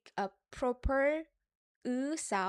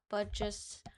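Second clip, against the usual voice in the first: ordinary delivery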